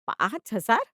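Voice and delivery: sharp rising shock on हजार; incredulous echo; disbelieving question. Acted. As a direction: fling back पाँच हजार as a loud question. Mother hears the real amount.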